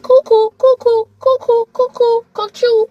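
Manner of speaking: high, loud cuckoo-bird calls; high mocking "cuckoo", over and over. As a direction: chirp it out like a crazy cuckoo clock. Comic cuckoo call about texts.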